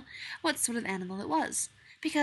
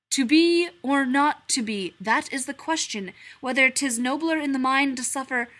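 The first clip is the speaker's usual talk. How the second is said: loud theatrical verse; declaiming Hamlet; 4 semitones higher, 9 dB louder than usual. Acted. Hamlet declaiming to the stranger, loud and theatrical. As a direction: proclaim it from the stage.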